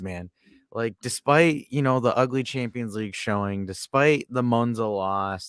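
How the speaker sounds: ordinary talk, nothing marked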